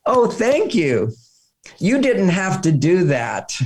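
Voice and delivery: slow, gushing thanks, lifted pitch; imitated appreciative tone. Wife to a helpful stranger at the door, slow gushing gratitude. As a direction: mimic a wife's drawn-out, flattered gratitude. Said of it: "the tone of voice when she said".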